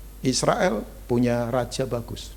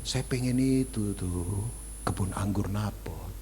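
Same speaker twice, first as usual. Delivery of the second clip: wheedling Jezebel; 3 semitones lower, 8 dB quieter, slower than usual. Retold or imitated. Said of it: "Dia berkata".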